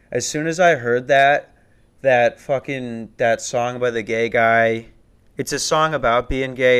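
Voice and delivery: ordinary talk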